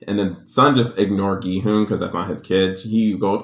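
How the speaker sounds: ordinary delivery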